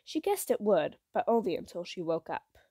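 plain reading voice

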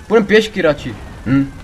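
ordinary talk

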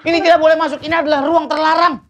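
high, loud telling-off; scolding shout. Acted. Guard tells vlogger the room is forbidden, scolding loudly.

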